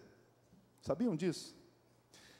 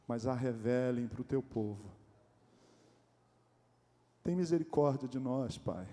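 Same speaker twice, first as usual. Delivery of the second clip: pastoral prayer; 3 semitones lower than usual. Acted. Leads a prayer.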